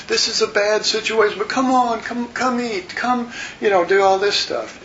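high, pleading voice; coaxing servants. Retold or imitated. Servants begging grieving David to eat, high pleading. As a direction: plead with him to come and eat.

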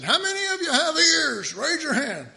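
high, loud call-out; calling to a crowd. Pretend. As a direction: ask the whole crowd loudly.

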